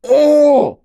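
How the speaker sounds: high, loud, drawn-out "oh"; delighted cry. Real, not acted. Streamer finds the roadrunner card, delighted cry. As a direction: squeal of happy surprise.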